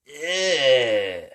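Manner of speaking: loud grossed-out groan; disgusted ugh. Acted. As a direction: groan at the smell. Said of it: "shouted the children".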